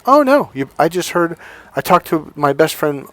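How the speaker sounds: imitated cheerfulness. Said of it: "she was really happy and cheerful".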